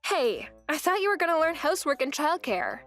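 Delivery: usual speaking voice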